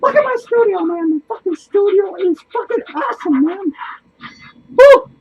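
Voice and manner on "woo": loud, high whoop of joy; excited whoop. Real, not acted. Showing off comics to viewers, loud whoop. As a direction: whoop it out with glee. Cheering over his studio.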